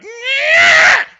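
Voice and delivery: high, loud strained "nyah" cry; a kid flailing a wand with a shriek; kid's effort yell. Retold or imitated. Imitating a kid swinging his wand wildly, shrill yell.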